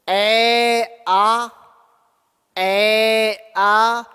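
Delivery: ए आ sounded out twice, vowels held clear; repeat-after-me demo. Shown as a demonstration. Teacher asks students to say it with him: held ए आ. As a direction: model ए आ for the class to echo. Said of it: "मेरे साथ ऐसे बोल करके देखो".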